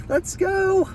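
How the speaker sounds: a very high, squeaky cheer of joy; squealing cheer. Real, not acted. Filming his dream snake, a very high squeal of joy. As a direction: squeal it out with pure delight. Thrilled at finding target snake.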